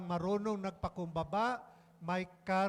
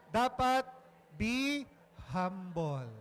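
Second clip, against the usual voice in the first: "dapat", "be", "humble" each set apart by long pauses, louder; emphatic preaching; 4 dB louder, much slower than usual. Real, not acted. Preacher hammering the lesson home to the congregation, slow and loud. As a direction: punch out "dapat be humble" word by word.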